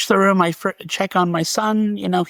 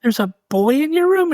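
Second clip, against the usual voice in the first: surprised; 10 semitones higher than usual. Retold or imitated. Father startled by son's claim.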